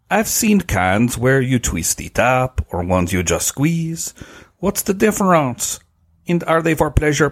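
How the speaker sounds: light put-on French accent, pitched a bit up. Reading a listener's letter to Sunny in a French accent. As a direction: read the letter like a playful Frenchman, a touch hammed-up. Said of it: "a French accent to do this one".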